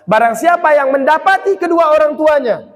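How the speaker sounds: usual speaking voice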